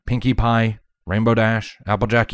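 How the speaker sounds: ordinary delivery, nothing marked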